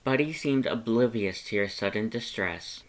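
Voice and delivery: ordinary delivery, nothing marked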